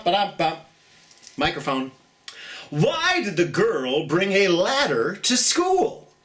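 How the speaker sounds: usual speaking voice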